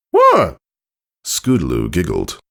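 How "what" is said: caught out. A narrator's reading. Apple Bloom caught eating.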